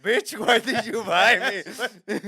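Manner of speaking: loud, high, slow mock screech; nasty cat. Cat turning on the person petting it, outraged.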